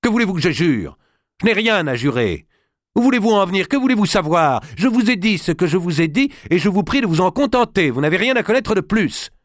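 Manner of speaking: high, loud, indignant king. Acted. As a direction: raise your voice, defensive and irritated. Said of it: "haussant le ton".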